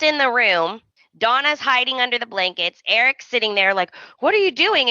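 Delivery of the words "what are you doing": higher, startled retold question; hammed-up caught-out husband; mock alarm. Retold confrontation in bedroom.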